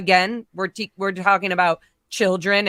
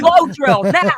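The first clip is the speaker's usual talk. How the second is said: excited; 7 semitones higher, 7 dB louder, slower than usual. Acted. Playful announcement.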